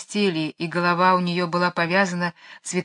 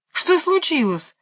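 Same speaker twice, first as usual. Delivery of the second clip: much higher, drawn-out startled question; startled cry; 10 semitones higher than usual. A narrator's reading. Jane sitting up in bed at the yell, crying out. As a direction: cry out high, demanding to know. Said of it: "закричала Джейн".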